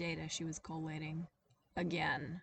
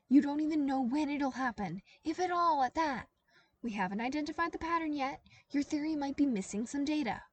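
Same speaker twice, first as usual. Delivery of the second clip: high, loud, fast exasperation; an exhausted intern loses patience with her boss; exasperated outburst; 8 semitones higher, 6 dB louder than usual. A narrator's reading. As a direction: throw it at her, fed up. Heated argument with boss.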